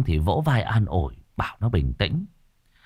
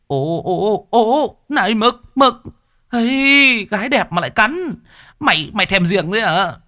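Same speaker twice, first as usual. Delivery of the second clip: high, loud joking scold of a dog; Long scolding the dog in front of a pretty girl; young man scolding dog; 13 semitones higher, 3 dB louder than usual. Acted. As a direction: hold the dog back and tease it.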